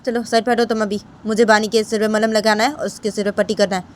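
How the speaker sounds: stern mother figure ordering her aside; short, firm commands with pauses; curt younger mother. Acted. (सख़्ती से हटाती छोटी माँ)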